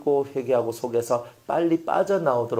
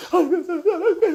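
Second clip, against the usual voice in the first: put-on high, laughing child promise; a forgiven child promising to behave; sorry child; 13 semitones higher than usual. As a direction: play the kid making up. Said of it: "이렇게 하잖아요".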